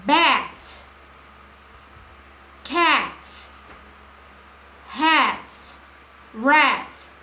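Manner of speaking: Bat, Cat, Hat, Rat said loud and clear, one at a time; rhyming drill. Shown as a demonstration. Teacher showing how the professor rhymes words. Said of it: "Ela vai fazendo assim".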